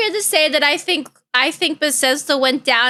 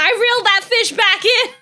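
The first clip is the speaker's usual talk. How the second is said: laughing; 7 semitones higher than usual. Real, not acted. Laughing while telling fish story.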